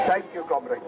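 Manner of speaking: normal talking voice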